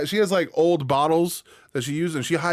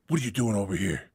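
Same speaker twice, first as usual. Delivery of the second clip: cop dog questioning; 6 semitones lower, 4 dB quieter, faster than usual. Pretend. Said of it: "He would just walk out and be like".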